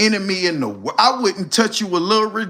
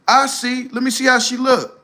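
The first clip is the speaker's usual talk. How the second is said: lightly voiced high Lucifer; 8 semitones higher than usual. Lucifer considers the request.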